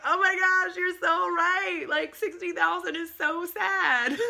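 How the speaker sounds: thrilled, high, loud exclamation; excited agreement. Retold or imitated. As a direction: burst out in delighted agreement. Excited agreement with her friend.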